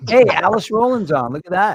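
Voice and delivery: light up as a friend joins the chat; excited greeting. Real, not acted. Host spotting Alice joining the stream, excited.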